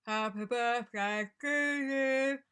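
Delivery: slow sung line, tongue pulled back and swallowed; swallowed dark vowels. Shown as a demonstration. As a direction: sing it with a backed, tense tongue. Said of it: "curl your tongue ... push it down your throat".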